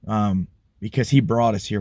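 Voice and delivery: ordinary talk, nothing marked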